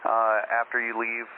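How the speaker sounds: ordinary delivery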